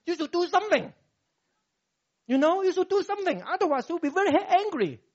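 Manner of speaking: mock the wife's scolding, quick and pushy; nagging wife. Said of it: "So she's very angry".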